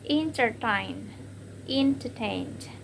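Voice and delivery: entertain, slow, final TAIN stressed. Shown as a demonstration. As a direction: stretch entertain out, landing on TAIN. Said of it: "it will receive primary stress".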